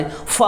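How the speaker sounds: फ said as a soft "fa"; isolated, quiet "fa" for फ; nukta f sound. Shown as a demonstration. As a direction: demonstrate फ as an airy f. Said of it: "क्या उच्चारण होगा".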